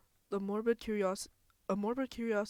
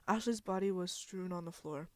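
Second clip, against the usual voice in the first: ordinary talk, nothing marked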